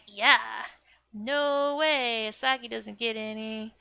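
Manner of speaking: loud mock-mom veto; emphatic refusal. Mom refusing to buy any for Asagi, emphatic. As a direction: imitate a mom firmly shutting it down, starting loud.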